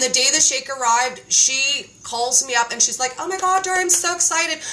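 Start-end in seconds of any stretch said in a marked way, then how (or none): 3.2-4.6 excited friend. Retold or imitated. Friend gushing on the phone.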